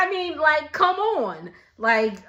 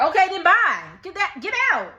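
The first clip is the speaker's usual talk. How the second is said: dismissive; 6 semitones higher, 4 dB louder, faster than usual. Real, not acted. Talks back to the TV.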